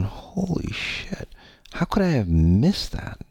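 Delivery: breathless, slow, hushed amazement; a stunned hushed holy shit; awed whisper. Retold or imitated. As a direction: gasp it out in wonder. Sudden revelation of real self.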